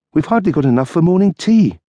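dry retort as the wife; wife's voice. Acted. Wife waiting up for her husband, tart retort.

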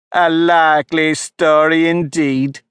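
slow, scornful and loud; deep contempt. A narrator's reading. Pigeon scorns Alice's claim, contemptuous. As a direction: sneer it out, dismissive. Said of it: "in a tone of the deepest contempt".

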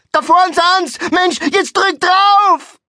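high, loud excited yell; urgent shout. Acted. Passenger spots the car ahead and yells to step on it, urgent shout. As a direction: yell it out, dragging the last word long.

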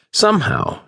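ordinary delivery

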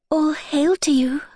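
put-on high, whispery trembling girl; frail maiden's voice, high and airy; gentle, trembling. A narrator's reading. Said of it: "in a gentle, trembling voice".

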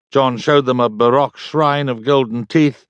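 plain reading voice

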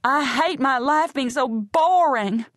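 slow, high screamed line; slow, drawn-out high scream. Acted. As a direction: scream it out through tears. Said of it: "and screams".

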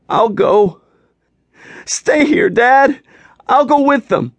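put-on high, loud, tearful pleading boy. Younger son begs his father to stay, high and tearful. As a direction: beg him to stay, voice cracking high.

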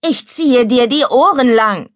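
scolding threat. Acted. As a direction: threaten him across the water. Threatening the boy in the pond.

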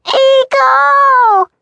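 put-on high loud toddler wail; wailing call. Toddler wailing her brother's name from the horse, high and loud. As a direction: wail the name like a tiny child. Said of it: "The baby wailed her brother's name".

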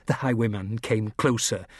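ordinary delivery

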